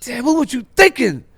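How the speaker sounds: incredulous yelling; callers' outrage. Retold or imitated. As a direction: chew him out over the joke. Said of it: "It's like".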